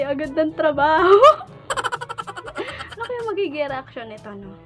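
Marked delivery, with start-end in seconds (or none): none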